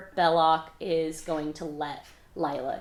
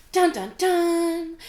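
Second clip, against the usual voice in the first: high, slow, drawn-out mock suspense sting; dramatic dun dun dun; 10 semitones higher, 5 dB louder, much slower than usual. Recapping a book, marks the cliffhanger with a dramatic sting. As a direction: sing out the cliffhanger like a movie score.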